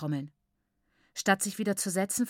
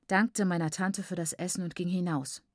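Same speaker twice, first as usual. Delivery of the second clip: usual speaking voice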